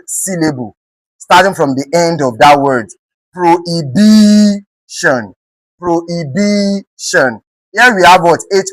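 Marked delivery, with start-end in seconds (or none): 3.3-5.3 Prohibition with a long, drawn-out BI; slow Prohibition, leaning hard on BI; stress on BI. Shown as a demonstration. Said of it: "B I being stressed". 5.8-7.4 prohibition, slow with BI held long; teacher's slow prohibition, weight on BI; stress on BI. Shown as a demonstration. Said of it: "B I being stressed".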